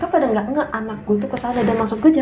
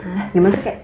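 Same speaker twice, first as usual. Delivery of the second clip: ordinary talk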